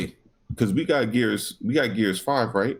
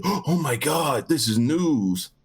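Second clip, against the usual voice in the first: mock amazement; 4 semitones higher, slower than usual. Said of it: "But it's not news".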